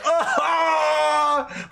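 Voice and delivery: long, high wailing cry; pained wail. Acted. Thug with an arrow in his eye, pained wail. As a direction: wail as you drop to the ground. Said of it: "He hits the ground wailing".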